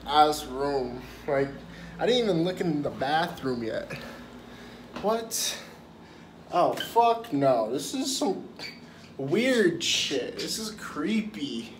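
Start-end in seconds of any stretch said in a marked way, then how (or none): 5.0-11.7 shocked disbelief. Real, not acted. Seeing the bathroom.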